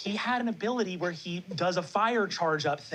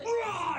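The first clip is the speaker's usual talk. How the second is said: high roar of rage; frustrated roar. Acted. Porter hurls a rock, frustrated roar. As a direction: let out a short acted roar while hurling something. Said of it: "And he goes".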